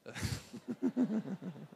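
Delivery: amused run of chuckles; real chuckle. Real, not acted. Preacher joking about mother-in-law to youth, chuckling. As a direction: chuckle at your own joke.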